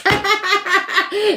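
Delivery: very high, loud burst of laughter; shrieking cackle. Real, not acted. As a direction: crack up at your own joke. Cracking up at own teasing.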